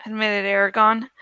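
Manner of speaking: ordinary delivery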